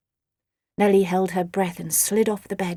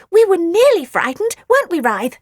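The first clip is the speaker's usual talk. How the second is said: fibbing creature voice; 8 semitones higher, 7 dB louder than usual. Acted. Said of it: "he fibbed".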